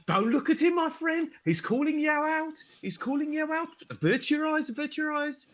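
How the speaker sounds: high, carnival barker calling out to the crowd; hype man announcer. Acted. Hype man calls the crowd to the shin-kicking, barker voice. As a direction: gather them round like a fairground crier.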